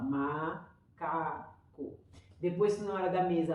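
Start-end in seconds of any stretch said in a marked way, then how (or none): 0.0-2.0 macaco read out very slowly, one syllable at a time; slow syllabic reading by a child. Shown as a demonstration. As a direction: stretch macaco into ma-ca-co like a child sounding it out. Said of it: "ela vai falar uma sílaba".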